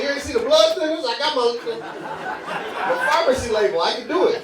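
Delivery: high-pitched protest of a refused customer; hammed-up indignant whine; mock pleading. Pleads to be sold the candy.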